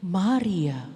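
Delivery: low, gentle calling of a name; deep tender call; Jesus calling. Acted. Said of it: "bilang kayak gini".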